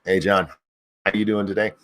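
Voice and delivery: normal talking voice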